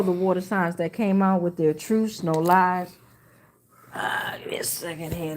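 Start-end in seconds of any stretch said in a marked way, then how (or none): 3.9-5.4 breathy aside under the breath; whisper. Real, not acted. Host to herself while loading the comments, whispering. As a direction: whisper it to yourself while scrolling.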